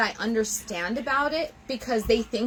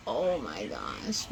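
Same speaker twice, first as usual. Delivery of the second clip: breathy sigh; 7 dB quieter, slower than usual. Real, not acted. Overwhelmed with love.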